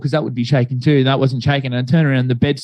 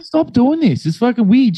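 high, raised, exasperated snap; fed-up retort; 8 semitones higher than usual. Retold or imitated. Son shouting at mum to stop, fed-up retort. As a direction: tell her off, fed up.

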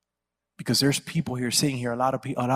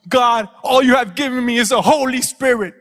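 high, loud mock wail; complaining to God; 11 semitones higher, 11 dB louder than usual. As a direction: whine loudly like a child feeling short-changed. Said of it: "We're complaining to God".

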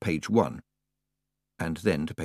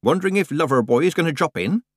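higher-pitched, grinning tease; teasing grin; 7 semitones higher than usual. A narrator's reading. Nanny teasing Tiffany about Lover Boy, grinning. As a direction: needle her playfully through a grin. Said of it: "she said with a big grin".

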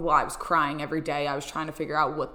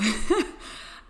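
breathy laugh; 10 semitones higher than usual. Real, not acted. Laughing at own plea.

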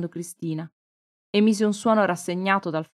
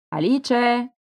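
a called-out name, louder and higher; calling out deep; 5 semitones higher, 5 dB louder than usual. A narrator's reading. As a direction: chef calls for Alice on entering, a mild loud call. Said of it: "chiamò con voce profonda".

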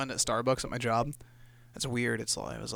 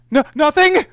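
much higher, louder panicked squeak; scared denial; 13 dB louder, slower than usual. Retold or imitated. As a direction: blurt it out in fright. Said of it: "'Cause I was afraid".

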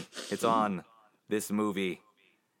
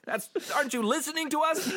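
much higher, laughing through a fake scolding; can't keep the angry act straight; laughing mock rant; faster than usual. Mock-scolding the listeners while cracking up with laughter.

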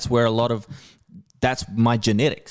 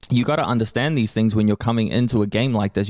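usual speaking voice

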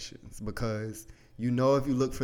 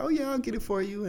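much higher, fast, easy promise; breezy promising dad; 13 semitones higher, faster than usual. Retold or imitated. Dad promising his kid a video game, high and breezy. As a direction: toss off the promise lightly. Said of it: "In the beginning, he's like".

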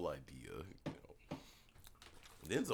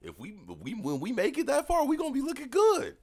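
very high, excited squeal building louder; hyped amazement; 6 dB louder than usual. Retold or imitated. Friend marvels at the family's good genes, hyped. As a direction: climb into a high, loud boast. Acted amazed boast about ageing well.

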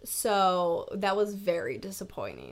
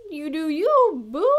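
sassy approval; 6 semitones higher, 3 dB louder than usual. Retold or imitated. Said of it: "I was like".

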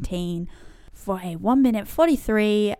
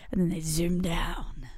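hushed wildlife narrator voice; nature documentary; 4 semitones lower, 6 dB quieter than usual. Acted. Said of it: "Sounded very like David Attenborough".

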